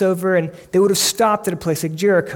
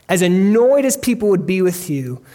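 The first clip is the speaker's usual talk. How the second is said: ordinary talk, nothing marked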